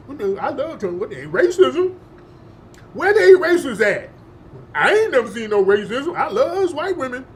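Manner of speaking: high, loud cartoonish buffoon voice; mocking caricature. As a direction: play a clueless fan in hammed-up, swooping high pitch. Mocking imagined naive Trump supporters.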